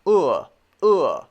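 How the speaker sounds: a short punched "uh" from the back of the throat; teacher demonstrating the book vowel; short oo sound. Shown as a demonstration. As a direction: push the jaw out and bark a short "uh". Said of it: "push your jaw out as you say it".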